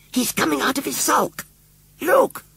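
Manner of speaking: very high, squeaky excited cry. A narrator's reading. Boy points out the stirring scarecrow, squeaky. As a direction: squeak it right at the top of the voice. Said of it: "he said squeakily".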